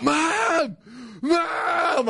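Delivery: high, panicked call for mom. Retold or imitated. Kid calling his mom for help, panicked and high. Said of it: "I'm like".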